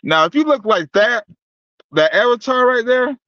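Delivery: höher und lauter, spöttisch; verhöhn ihren Avatar lautstark; taunting. Real, not acted. Taunting her about the avatar.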